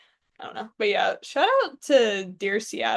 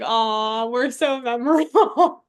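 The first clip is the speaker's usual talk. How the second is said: playful, louder gushing with a laugh; mock gushing; 6 dB louder, slower than usual. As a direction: gush as if touched and flattered. Gushing retold reaction to shout-out.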